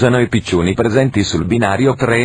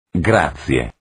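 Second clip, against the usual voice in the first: low announcer sign-off; 6 semitones lower than usual. Acted. Sign-off after each announcement.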